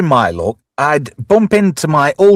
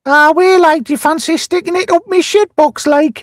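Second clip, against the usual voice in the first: high-pitched caricature of a Geordie lass; hammed-up Geordie, pitched far up; mock Geordie accent; 3 dB louder than usual. Geordie lass propositioning him, mock Geordie accent. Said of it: "is the Geordie accent".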